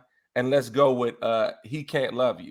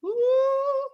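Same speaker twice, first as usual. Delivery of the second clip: high ooh. Real, not acted. Reacting to picking Adore.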